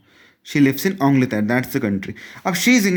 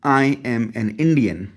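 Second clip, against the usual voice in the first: drawn out, very slow and deliberate; careful teacher demo pace; slow model sentence; 3 semitones lower, much slower than usual. Shown as a demonstration. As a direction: say it slowly for the class. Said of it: "Mine is".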